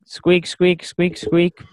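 high squeaky toy noise; rubbery high squeaky toy. Pretend. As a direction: squeak like a rubber clown horn. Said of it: "I'm doing it with my mouth".

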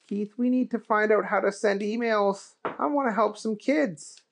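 earnest, eager to help; Booger voice. Acted.

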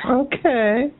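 high, sing-song mocking okay; sassy okay. Sassy sign-off after insult.

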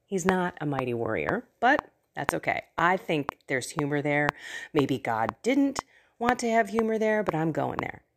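normal talking voice

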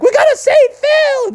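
rally everyone to the rescue; desperate rallying cry. Acted. Townspeople jump into the hole.

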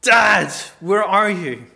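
high, very loud anguished cry; full-force acted yell for help; desperate scream. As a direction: scream for your father from the bottom of a pit. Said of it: "and you scream".

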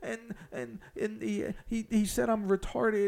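imitating a hurt teenager tattling to parents; mock complaining kid voice; mimicking a whiny teen. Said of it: "don't go home and be like".

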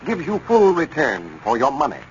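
ordinary talk, nothing marked

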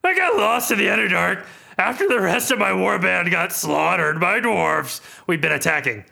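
gruff half-orc voice; Ront voice. Acted. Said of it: "these voices are really taking a toll".

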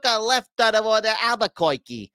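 high, stretched cartoon wiseguy voice; Bugs Bunny. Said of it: "Bugs Bunny. He uses, he always said".

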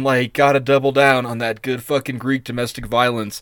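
ordinary talk

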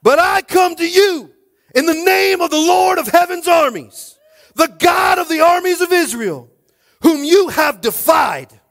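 slow, high, full-voiced proclamation shout; defiant David. David invoking the Lord against Goliath, defiant shout. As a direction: declare it to the giant at the top of your lungs. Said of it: "David replied to the Philistine".

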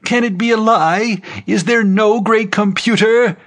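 high-pitched anguished outcry; screaming. A narrator's reading. Preacher screaming over the uproar, high and desperate. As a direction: cry it out high, in disbelief.

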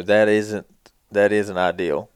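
normal talking voice

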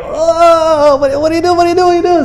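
high squealing alarm; mock panic. Blind man with mud pressed in his eyes, high mock panic. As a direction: babble in hammed-up panic, very high.

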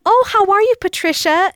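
high, loud, gushing hello; effusive greeting. Acted. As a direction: greet a friend with acted delight. Acts out greeting a friend.

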